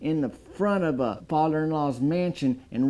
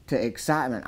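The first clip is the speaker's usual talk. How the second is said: ordinary delivery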